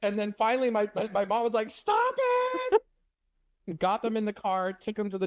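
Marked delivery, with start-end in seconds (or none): 1.7-3.8 sudden high mock yell; imitating mom's shrill high yell; mom yelling stop. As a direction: shriek it like a frantic mom. Mom yelling at bleeding brothers.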